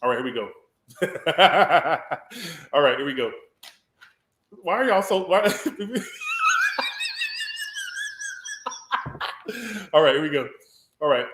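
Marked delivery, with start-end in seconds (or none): 1.0-2.7 villain laugh. Acted. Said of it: "I got a villain laugh". 5.5-9.9 villain laugh. Acted. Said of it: "I got a villain laugh".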